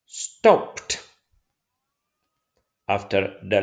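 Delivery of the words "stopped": "stopped" with the ED said as T; final T sound. Shown as a demonstration. Teacher giving an example of ED after P, final T sound. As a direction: end "stopped" on a voiceless T, no extra syllable. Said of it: "we pronounce T".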